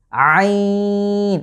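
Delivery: throaty squeezed আইন held out; Arabic letter ain. Shown as a demonstration. Teacher showing viewers the throat letter, strained long আইন. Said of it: "এবং কণ্ঠে চাপ পড়ছে".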